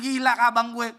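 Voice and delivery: loud laughing jeer; laughing siblings. Retold or imitated. Younger siblings laughing at their brother's calling. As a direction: crack up at big brother.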